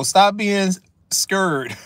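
usual speaking voice

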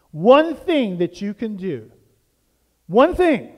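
usual speaking voice

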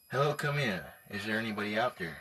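normal talking voice

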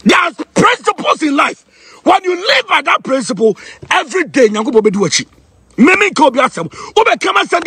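high-pitched, forceful sermon delivery; preaching. Acted. Preacher on living by principles, high impassioned preaching. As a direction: declaim it like a fired-up preacher.

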